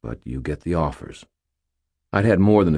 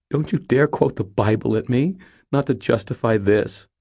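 put-on high, louder, upset young woman; Molly, indignant; 8 semitones higher, 6 dB louder than usual. Molly scolding Harry for quoting the Bible, high and indignant. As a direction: scold him in a raised, higher voice. Voices Molly angrily protesting.

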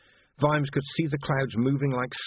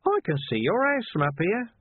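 high, cheeky singsong taunt; 10 semitones higher than usual. A narrator's reading. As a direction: tease him from the rooftop.

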